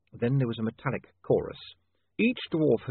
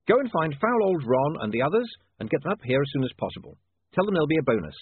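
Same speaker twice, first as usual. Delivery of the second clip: brisk, raised-pitch instructions; eager young boss, pitched up; William giving orders; 7 semitones higher, 4 dB louder than usual. Acted. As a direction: send someone off on an urgent errand. William gives the troll orders.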